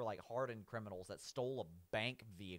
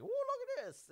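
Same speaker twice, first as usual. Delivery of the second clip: Shaggy voice; 4 dB louder than usual. Retold or imitated. Shaggy the stoner finding a coin, high Shaggy voice. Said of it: "that's my Shaggy voice".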